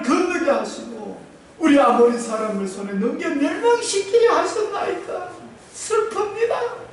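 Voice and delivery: high, loud, slow crying prayer; wailing lament. Retold or imitated. Joshua lamenting before God, a high, slow, loud wail. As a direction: pour out grief before God.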